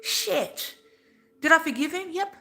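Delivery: high, loud, charged; emotional outburst. Real, not acted. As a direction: let the feeling burst through. Emotional outburst about forgiving abuser.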